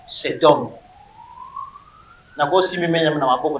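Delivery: usual speaking voice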